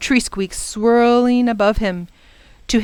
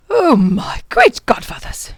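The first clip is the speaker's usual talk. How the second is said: higher, emphatic exclamation; overwhelmed professor; 4 semitones higher, 4 dB louder than usual. Acted. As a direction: exclaim in exhausted dismay. Exhausted professor sinks onto grass.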